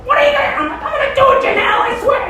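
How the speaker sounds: mock panicky squeak of a cornered fiancé; high pleading voice. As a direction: beg the glaring mother to believe you. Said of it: "it'd be like".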